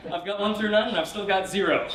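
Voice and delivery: cracking up at the pun; can barely get the words out for laughing. Real, not acted. Laughing at the joke.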